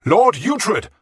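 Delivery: high, loud stern command; king demanding obedience; loud call. Acted. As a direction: shout him down. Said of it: "Alfred called again".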